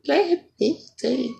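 very high put-on singer voice; mock the hook way up high. Comic repeats the hook, high mock singer voice. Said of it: "We want [name]".

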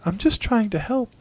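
put-on high, hurt little boy voice; small boy, a touch wounded; ghost child. A narrator's reading. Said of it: "looking wounded".

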